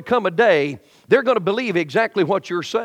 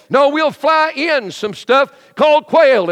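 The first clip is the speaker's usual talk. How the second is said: slow, higher, louder mock-patient God; God answering; 7 semitones higher, 5 dB louder, slower than usual. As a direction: drag it out like a weary God. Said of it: "God answers again".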